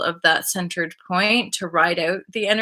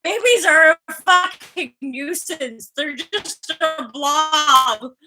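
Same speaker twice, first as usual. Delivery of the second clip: high, drawn-out comic complaint; hammed-up baby-hater; mock disgusted rant; 7 semitones higher, much slower than usual. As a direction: stretch it out in exaggerated exasperation. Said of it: "I'm like".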